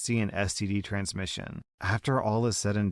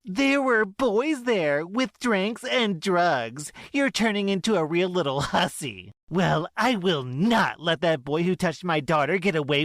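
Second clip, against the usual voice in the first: scolding mother; 9 semitones higher, 4 dB louder than usual. Acted. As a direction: berate the girl as a hussy.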